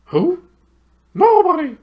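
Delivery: very high, loud hooted reply; high, loud owl hoot. Acted. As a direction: hoot it at the deaf dwarf. Owl hooting.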